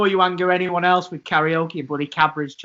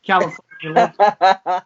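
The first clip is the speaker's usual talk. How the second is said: slow, drawn-out California Love in broad Yorkshire; broad Yorkshire accent; 3 dB louder, slower than usual. Retold or imitated. Mate recalls his karaoke hit, broad Yorkshire accent. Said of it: "a really broad Yorkshire accent".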